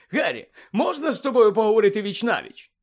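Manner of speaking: high, loud, hearty asking; eager request. Acted. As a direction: pull him aside with gusto. Bagman eagerly draws Harry aside.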